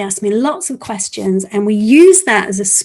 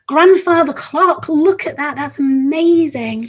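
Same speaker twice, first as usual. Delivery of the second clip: acted amazement; 6 semitones higher, 4 dB louder than usual. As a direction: marvel theatrically to impress children. Acted amazement at unveiled clock.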